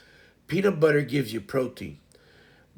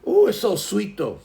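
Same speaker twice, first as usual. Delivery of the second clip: delighted; 7 semitones higher, 6 dB louder than usual. Real, not acted. Delight over apricot.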